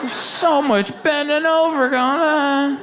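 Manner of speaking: high, drawn-out mock complaint; hammed-up lazy moaning; mock whining. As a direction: whine it slowly like it's unbearable. Exaggerated repeat of lazy complaint.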